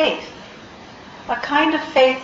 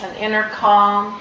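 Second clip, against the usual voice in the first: ordinary talk, nothing marked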